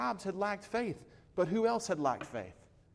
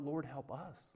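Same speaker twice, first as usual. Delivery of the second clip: much softer, hushed plea; soft cry to God; 2 semitones lower, 10 dB quieter than usual. Retold or imitated. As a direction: let the prayer sink to a hush. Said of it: "she cries out".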